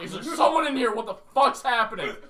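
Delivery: panicked yell. Retold or imitated. Banging his head, yelling at silent roommate in panic. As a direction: yell into the dark room in alarm. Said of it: "I'm like".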